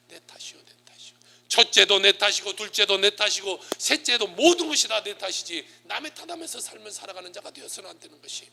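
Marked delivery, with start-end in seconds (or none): 0.1-1.1 very soft, almost whispered admission; soft, humble murmur; hushed confession. Shown as a demonstration. Preacher models owning the blame. 1.5-5.7 loud, raised sermon declaration; forceful pulpit insistence; emphatic preaching. Real, not acted. Preacher hammers home the lesson.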